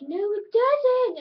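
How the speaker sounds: high, loud, drawn-out denial; mock contradiction. Retelling his comeback in a squabble, hammed-up protest. Retold childish back-and-forth squabble.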